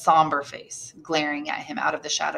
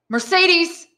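high, loud happy call; joyful shout; 12 semitones higher, 6 dB louder than usual. A narrator's reading. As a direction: call her name with joy from outside. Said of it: "shouted a joyful voice".

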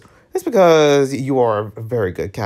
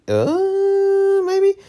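timid squeak. Acted. Voicing a timid character.

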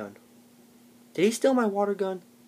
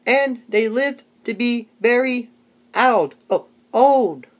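slow, halting, higher and louder read-aloud with squeaky peaks; mocking Tony's reading; 6 semitones higher, 8 dB louder, much slower than usual. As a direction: ham up a clueless kid sounding out a story. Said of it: "this was Tony".

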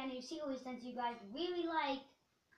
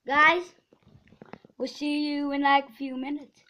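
loud; 11 dB louder than usual. Real, not acted. Loud sign-off to camera.

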